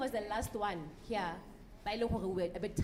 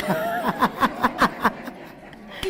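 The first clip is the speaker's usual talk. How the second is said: big burst of laughter; loud laughter; 13 dB louder than usual. Real, not acted. Loud laugh after joke.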